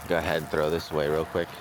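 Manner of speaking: normal talking voice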